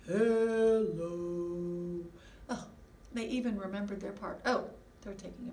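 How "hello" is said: low, drawn-out sung hello; Bo's voice. Pretend. Boy puppet answering in a low voice. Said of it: "and he says".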